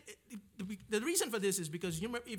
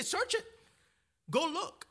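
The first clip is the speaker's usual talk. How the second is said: much higher, sneering mock voice; mock them in a high, snotty voice; mocking leaders; 9 semitones higher than usual. Preacher mocking the leaders' smug challenge, high and sneering.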